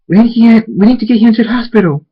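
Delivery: high, loud, fast alarm; panicked urgency. Acted. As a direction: rush it out, help is needed now. Urgent call to get help.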